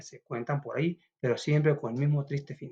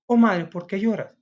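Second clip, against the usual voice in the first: put-on higher, louder child's voice asking; a child startled by mother crying; worried child; 6 semitones higher, 6 dB louder than usual. Child asks weeping mother why, higher, louder worried voice.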